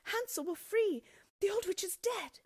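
very high, loud happy shout; joyful cry. A narrator's reading. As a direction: shout the news for joy, high. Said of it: "crying out".

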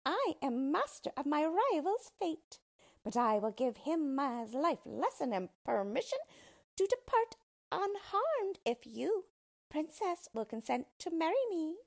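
high-pitched little villain; triumphant, high character voice; gloating dwarf. A narrator's reading. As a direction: dictate terms in a high dwarf voice. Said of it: "said the dwarf".

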